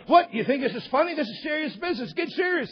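hammed-up high-pitched telling-off; indignant scolding. Recruiter scolds the laughing kid.

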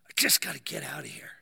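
low, forced, half-voiced urgency; desperate strained plea. Claustrophobic man at breaking point, desperate strained plea. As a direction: push the whisper up into a low, desperate rasp. Third, stronger take of panicked plea.

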